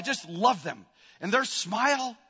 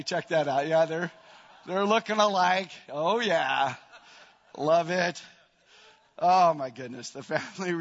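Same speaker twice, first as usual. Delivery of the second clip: ordinary talk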